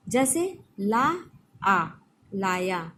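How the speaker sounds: ला and आ slowly pulled apart, then लाया; ला ... आ ... लाया, slow and separate; syllables drawn out. Shown as a demonstration. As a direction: stretch ला, pause, आ, then join as लाया. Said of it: "actually हम क्या बोलना चाहते हैं".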